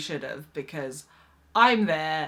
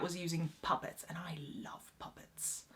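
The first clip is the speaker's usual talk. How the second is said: whispered aside; 2 semitones lower, 14 dB quieter than usual. Real, not acted. Whispered confession about loving puppets.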